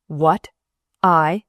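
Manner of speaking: a man who can't believe he's asked; incredulous demand. A narrator's reading. Surgeon disbelieving his nurse's request, a startled demand. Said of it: "he demanded".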